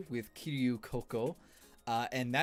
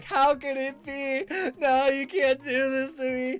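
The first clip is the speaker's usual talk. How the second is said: high, slow, louder despairing wail; mock wailing; 12 semitones higher, 9 dB louder than usual. Retelling the breakdown at the crane game, mock crying wail. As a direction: beg the heavens in mock despair. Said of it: "she's still crying".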